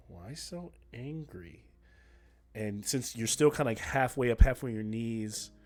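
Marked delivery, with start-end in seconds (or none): 0.1-1.7 soft, slow and teasing; teasing question. Acted. Ranger gently taunting the angry hero, quiet and slow. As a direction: ask it quietly, almost amused. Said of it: "it goes".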